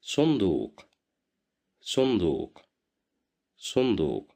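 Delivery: صندوق repeated with heavy emphatic s; model word. Shown as a demonstration. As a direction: say صندوق three times for learners. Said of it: "you will listen to me".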